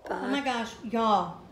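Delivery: ordinary talk, nothing marked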